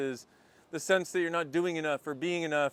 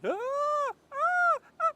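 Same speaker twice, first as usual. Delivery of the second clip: strained high cry; much slower than usual. Real, not acted. Arm straining holding phone.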